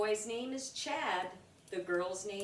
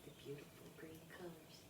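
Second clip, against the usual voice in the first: whisper aside; 5 semitones lower, 19 dB quieter, much faster than usual. Real, not acted. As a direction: whisper it to the little one. Aside to child while reading.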